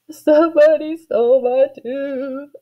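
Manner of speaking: high, drawn-out slow wail; sobbing. A narrator's reading. Girl crying to her gathered family, sobbing. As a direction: wail it through mock tears. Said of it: "I sobbed".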